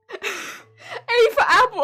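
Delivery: slow, drawn out through laughter; imitating the caller while laughing; laughing quote. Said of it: "quote after laughter".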